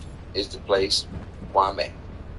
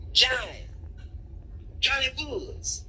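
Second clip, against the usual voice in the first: high voiced name; 4 dB quieter than usual. Dealer gives his name.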